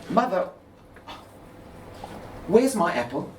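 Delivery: put-on high, bright plea of a little girl; little girl asking for her apple; high child voice. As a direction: call down like a small child wanting her apple.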